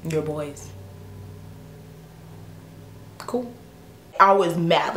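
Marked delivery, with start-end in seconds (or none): 0.0-3.5 very soft, long silences between words; retold icy understatement, barely voiced; stunned deadpan. Woman hearing she is dropped for his boys, quietly unimpressed.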